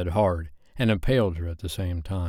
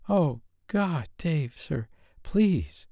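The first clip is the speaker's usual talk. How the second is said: high, pleading woman's voice; breathless pleading; begging; 8 semitones higher, much slower than usual. A narrator's reading. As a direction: cry out to him, begging. Lover begging in bed.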